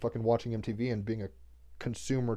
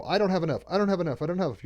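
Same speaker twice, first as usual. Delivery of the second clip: higher, repetitive worried chant; mock fretting; 6 semitones higher, faster than usual. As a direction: imitate an anxious person stuck on one thought. Mimics anxious repeated scarcity thought.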